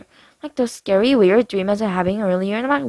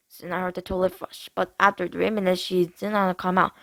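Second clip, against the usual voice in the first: plain reading voice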